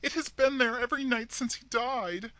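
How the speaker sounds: high, loud fearful cry. A narrator's reading. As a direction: cry it out in dread. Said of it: "cried Rebecca".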